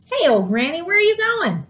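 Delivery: high prickly voice of a cactus calling out; talking cactus. Acted. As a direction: call up to Granny like a spiky little plant. Talking cactus calls to Granny.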